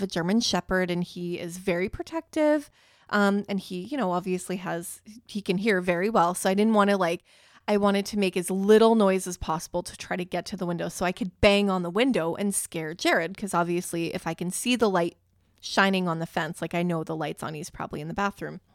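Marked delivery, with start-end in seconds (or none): none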